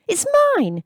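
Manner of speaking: put-on boy pleading for his father's case; upset protest. A narrator's reading. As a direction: plead for it back. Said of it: "he protested".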